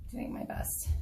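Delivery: a soft, voiceless aside to the viewers; whispered aside. Real, not acted. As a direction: whisper "doing my best" under the breath. Aside while filming in the dark.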